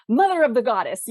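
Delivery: a quick, hammed-up in-world swear; mock fantasy oath. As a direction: blurt it out like a character swearing by her goddess. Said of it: "say something like".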